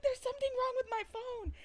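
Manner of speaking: high, squeaky explanation; mock flustered. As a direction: plead comically in a high little voice. Reenacting explanation to 911 operator.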